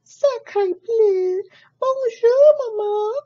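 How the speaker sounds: put-on very high, loud baby duck voice. Baby duck greets cat as mama, very high and loud. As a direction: squeal it up at mama. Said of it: "looked up at Jack and said".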